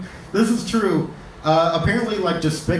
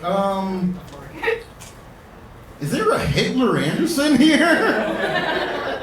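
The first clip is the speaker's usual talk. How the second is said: slow, high, puzzled teacher reading roll call; mock teacher; 6 semitones higher, much slower than usual. Teacher taking roll call, slow and high with disbelief. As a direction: stretch it out like a baffled teacher. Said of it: "Teacher's just like".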